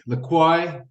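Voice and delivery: LaCroix said as "luh-KWY"; unsure pronunciation. Shown as a demonstration. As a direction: try LaCroix with a KWY ending, no R.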